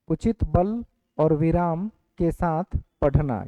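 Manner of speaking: slow, spaced-out dictation with बल stressed. Shown as a demonstration. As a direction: read it out so the class can copy it. Teacher dictating notes. (कक्षा को धीरे-धीरे notes लिखवाना)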